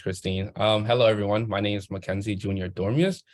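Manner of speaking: usual speaking voice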